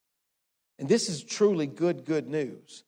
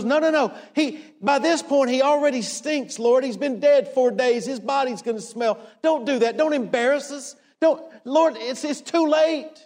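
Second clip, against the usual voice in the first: higher-pitched, shocked protest; shocked Martha; 5 semitones higher than usual. Acted. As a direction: plead in alarm, pitched up. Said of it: "Martha's shocked. She goes".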